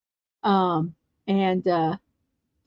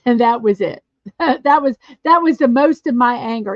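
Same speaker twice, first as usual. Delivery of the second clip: normal talking voice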